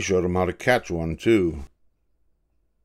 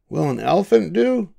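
lightly voiced eager boy, pitched high; a grandpa reading young Davy's curious question up high; 11 semitones higher, 4 dB louder than usual. A narrator's reading. Grandpa voices boy Davy asking his father, high and eager.

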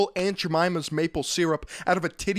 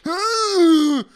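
drawn-out stoner yeahs, climbing higher and louder; mock stoner tone; 4 semitones higher, 4 dB louder, much slower than usual. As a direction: exaggerate a dazed stoner till it becomes a wail. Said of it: "tone of his voice where he's like".

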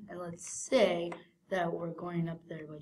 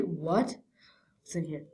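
ordinary talk, nothing marked

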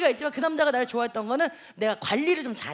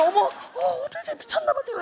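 mock flustered squeal, high-pitched; 당황한 엄마; 9 semitones higher than usual. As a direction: imitate a mortified mom in a high wail. Acting mother's flustered reaction. (어쩔 줄 모르는 엄마 흉내, 높은 소리로)

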